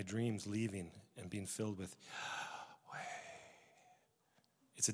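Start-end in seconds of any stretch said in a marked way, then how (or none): 2.0-3.0 the name as a deep breath; inhale, then exhale the name Yahweh; soft, slow breath sounding like a word. Shown as a demonstration. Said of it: "what does it sound like when you breathe".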